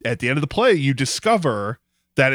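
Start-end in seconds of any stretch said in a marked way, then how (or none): none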